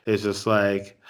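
ordinary delivery

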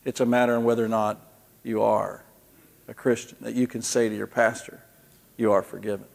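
hushed earnest reply to the men; quiet earnest. Retold or imitated. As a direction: say it softly but firmly, like a pastor.

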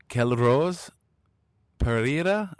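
normal talking voice

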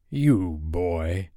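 slow pirate captain voice; single out a boy as the menacing captain; Captain Hook. Acted. Hook addressing John, menacing pirate voice.

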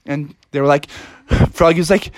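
ordinary delivery

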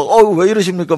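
high, loud cry of alarm; acted panicky objection; flustered protest. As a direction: protest as chaos breaks out. Disciples in uproar, acted.

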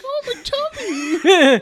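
high, slow, loud fake wail; mock pained whine. Friend imitating Alex hurt in the stomach, whiny mock cry. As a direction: moan it like a hammed-up bellyache.

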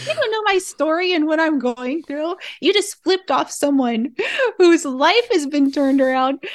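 high, amused mock scolding; laughing mock indignation. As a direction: protest loudly with laughter bubbling through. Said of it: "I was laughing, like".

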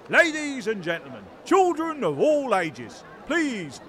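showman announcement building to a high shout; ringmaster. Acted. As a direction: announce the star of the show. Announcer presents the condemned.

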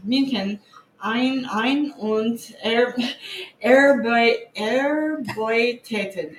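slow, halting German reading; terrible German. Real, not acted. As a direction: stumble through German syllables. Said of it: "my terrible German".

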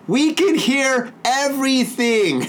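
high, slow, drawn-out teasing quote; mock announcement. Co-host imagining friend telling the neighbours, mock announcement.

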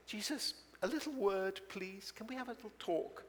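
high, soft confidential request; discreet aside. Acted. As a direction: take him aside for a quiet word. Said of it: "he took Jesus to one side".

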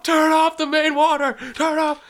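high, strained frantic hollering; re-enacted panicked yelling. Husband yelling to wife about flooding shower, imitated yelling. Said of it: "so I start yelling at Mandy".

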